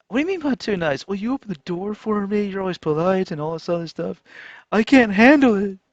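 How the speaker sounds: high-pitched, hammed-up complaint; mocking re-enactment. Rejected man and woman arguing over niceness, mockingly high. Mocks exchange over being too nice.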